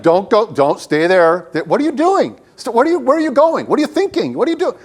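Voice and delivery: high-pitched ranting at other cars; hammed-up road-rage fluster, pitch shooting up; agitated driver. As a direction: fire off exasperated questions at bad drivers. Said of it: "I'll be talking to other cars".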